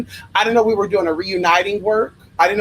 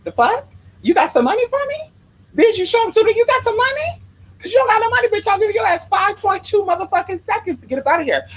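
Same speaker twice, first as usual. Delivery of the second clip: high, sassy reenacted scolding; mock telling-off; 13 semitones higher than usual. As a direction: chase the men off the doorstep. Telling off Craigslist visitors.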